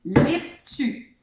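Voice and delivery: tulipe said backwards as lip-tu; syllables swapped. Shown as a demonstration. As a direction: sound out the reversed syllables. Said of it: "on inverse".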